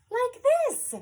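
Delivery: loud, high, bouncy announcement; loud dog hello. Acted. Reader introduces a dog's hello, loud and high. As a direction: belt it out high for a noisy dog. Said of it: "is licky and loud".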